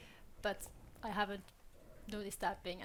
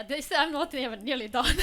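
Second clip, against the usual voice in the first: laughing through the words, louder; amused at her own long list; giggling while she speaks; 5 semitones higher, 12 dB louder than usual. Real, not acted. Listing her many languages, laughing.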